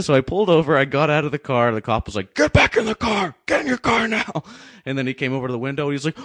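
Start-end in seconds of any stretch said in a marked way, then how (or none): 2.3-4.4 high, loud mock police order; shouting cop impression. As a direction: imitate a cop yelling a command. Cop ordering him back, retold.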